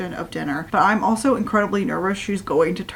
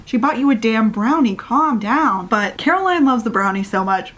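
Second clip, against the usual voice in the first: ordinary talk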